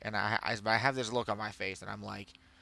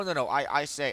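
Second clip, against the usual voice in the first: usual speaking voice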